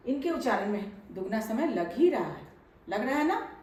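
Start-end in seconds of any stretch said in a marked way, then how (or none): none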